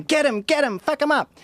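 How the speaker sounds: high mocking mob chant; mock mob shout. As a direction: imitate the hyena crowd. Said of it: "they're going".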